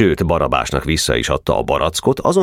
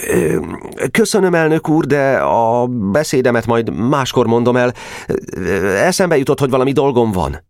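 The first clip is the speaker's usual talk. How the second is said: put-on a higher, hurried, hesitant boy; flustered boy; 8 semitones higher than usual. Nemecsek excusing himself to follow Geréb, flustered. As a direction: back out of the speech.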